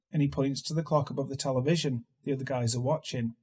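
usual speaking voice

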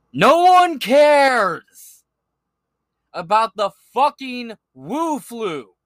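high, loud, very slow sneering yell; mocking yell. Real, not acted. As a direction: drag it out, mocking the headline. Mocking rant at the news.

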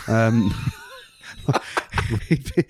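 ordinary talk, nothing marked

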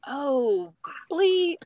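sudden startled high exclamation; surprised. Retold or imitated. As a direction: blurt it out in shock. Husband sees baby's head.

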